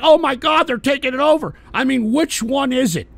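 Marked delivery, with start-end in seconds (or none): 0.0-1.5 high, loud mock alarm; mock panic. Mocking MAGA viewers, hammed-up panic. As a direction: ham up a panicked voter. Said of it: "are you gonna be like".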